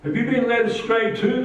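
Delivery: high, scornful sneer; mock the guards as fools. A narrator's reading. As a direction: Pharisees mocking their own guards. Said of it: "the Pharisees mocked".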